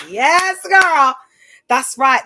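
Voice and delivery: loud, high hype cheer; hype shout. Real, not acted. Hyping herself up.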